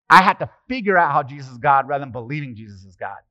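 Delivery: ordinary delivery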